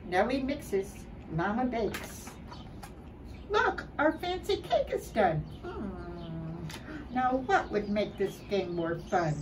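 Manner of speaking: ordinary delivery, nothing marked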